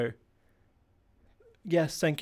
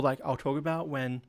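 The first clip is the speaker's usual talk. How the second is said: ordinary delivery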